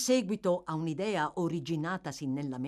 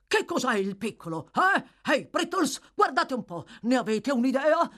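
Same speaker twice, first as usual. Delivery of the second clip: high, louder startled questioning; excited calling out; 7 semitones higher, 4 dB louder than usual. A narrator's reading. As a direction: call a companion over in excitement. Giles exclaims, calls Brittles over.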